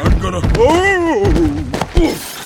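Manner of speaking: high slow yelp as he trips; startled stumble. Acted. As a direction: cry out while tumbling. Giant tripping on shoelaces.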